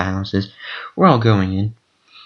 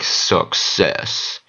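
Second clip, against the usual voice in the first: game announcer; 5 semitones lower, 3 dB quieter than usual. Retold or imitated. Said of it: "it's like".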